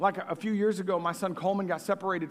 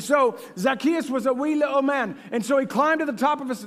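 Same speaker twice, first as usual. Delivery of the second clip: playful, higher mock British voice; British accent; 6 semitones higher, 4 dB louder than usual. Preacher retells Zacchaeus to the congregation, hammed-up British accent. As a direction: put on a British accent, raised and quick at the end. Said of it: "that's a good accent".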